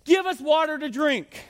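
high, drawn-out mock demand; hammed-up complaint, slowly stretched; mock quarrelsome demand. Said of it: "they quarreled with Moses and said".

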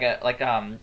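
ordinary delivery

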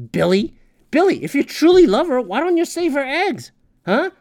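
high, loud mock call to Billy. Ranter calls out to Billy, high and loud. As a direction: call him out by name.

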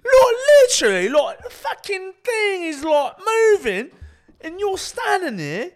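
very high, strained British ranting; mock British accent. Lad rants about things moving, mock British accent. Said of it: "it's an easy impression".

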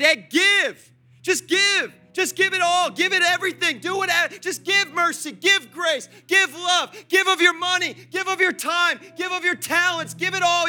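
God's urgent command. Acted. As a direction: preach God's command to give at full force. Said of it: "that he said".

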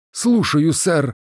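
high-pitched military acknowledgement; adjutant at attention. A narrator's reading. Adjutant answering the commander's reprimand, standing to attention. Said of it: "ответил адъютант и вытянулся".

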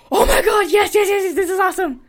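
high, loud thrilled yells; excited shouting. Retold or imitated. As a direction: shout yes with delight. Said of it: "I was like".

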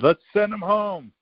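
high mock voice quoting a teammate; squeaky take on the player's call; a high-pitched teammate calling to send him home; teammate's call.

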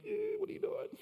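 squeaky high mock kid voice, puzzled; puzzled classmates. Boy mimics classmates baffled by his bare feet, high mocking squeak. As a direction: imitate the schoolkids staring, bewildered.

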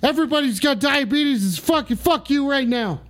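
high-pitched cursing rant; angry tirade. Real, not acted. Host cursing out the whole audience, angry tirade.

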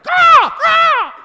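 screeching raven cry, very high and loud; raven caw. Retold or imitated. Preacher showing the Greek word as a raven's scream, screeched. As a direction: shriek it like a crow. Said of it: "scream like".

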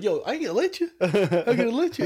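high, insistent refusal; nervous refusal. Retold or imitated. Answering the coworker's threat, high insistent refusal. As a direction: hold the line, voice climbing.

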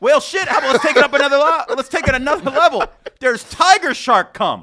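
hyped-up rant about the ocean; excited. Real, not acted. Escalating gross-out bit.

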